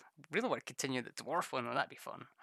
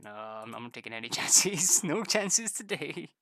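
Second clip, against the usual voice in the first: mock determined; 3 semitones higher, 7 dB louder than usual. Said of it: "I was like".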